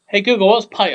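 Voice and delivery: loud, high call to a voice assistant. Real, not acted. Streamer asking Google what pike is, calling out.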